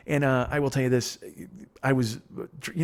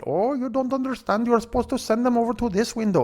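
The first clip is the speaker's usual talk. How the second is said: high-pitched, stretched-out mock Indian accent; bad Indian accent; 11 semitones higher than usual. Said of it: "I can't do the accent, but".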